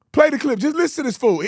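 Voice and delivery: usual speaking voice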